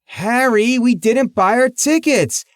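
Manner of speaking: high shrill nagging wife voice; shrill woman. A narrator's reading. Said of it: "That shrill woman kept saying".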